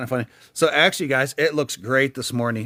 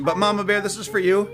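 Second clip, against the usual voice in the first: higher, upbeat shout-out; cheerful dedication; 6 semitones higher than usual. Real, not acted. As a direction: give her a cheerful shout-out. Dedicating to follower.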